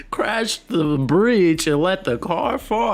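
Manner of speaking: normal talking voice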